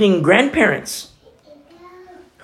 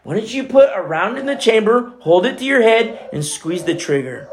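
raised, driving accuser's voice; accusing taunt; 4 semitones higher than usual. Acted. Preacher voicing Satan condemning a sinner, accusing taunt. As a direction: goad and condemn with rising intensity.

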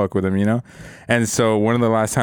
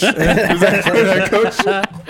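higher and louder, laughing plea to coach; louder mock clueless rookie; 8 semitones higher, 4 dB louder, slower than usual. Rookie repeating his question to coach, louder and laughing. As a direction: push the lost gamer bit harder and louder. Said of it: "mocking himself as a rookie".

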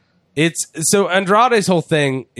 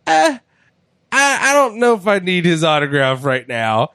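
unimpressed shrug; 5 semitones higher than usual. Retold or imitated. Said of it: "I'd be like".

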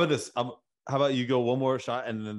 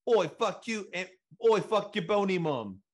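mock British accent, pitched higher; 9 semitones higher than usual. Host imitates a British rapper in a higher mock British accent. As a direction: do a cheeky higher British lad. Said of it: "if you were British".

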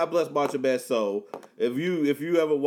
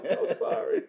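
high, giggling through sorry; laughing apology; slower than usual. Real, not acted. Apologizing to his cohost while still laughing. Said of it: "you are cracking yourself up".

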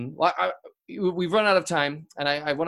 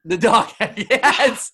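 laughing; 6 semitones higher, 9 dB louder, much slower than usual. Real, not acted. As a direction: laugh through the dog egg. Host repeats the dog egg laughing.

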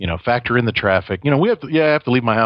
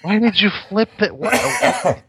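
laughing question; 13 semitones higher, slower than usual. Real, not acted.

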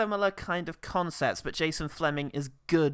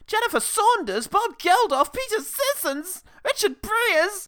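cameo roll call; 13 semitones higher, 5 dB louder than usual. Acted. Announcing party cameos.

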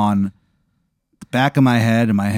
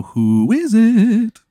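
funny phone answer; 10 semitones higher, much slower than usual. Acted. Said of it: "Just be funny".

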